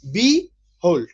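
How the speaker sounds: Behold said slowly as bi-hold, two clear beats; split into syllables. Shown as a demonstration. Teacher separates the word for his students, slow and deliberate. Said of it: "আমি আলাদা করছি".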